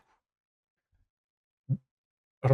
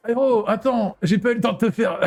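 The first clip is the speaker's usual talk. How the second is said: put-on boy voice; 8 semitones higher than usual. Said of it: "Comment je vais faire sa voix, ce mec".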